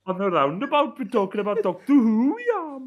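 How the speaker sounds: loud, high fake Welsh accent; Russell T Davies. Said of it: "Where was that coming from?".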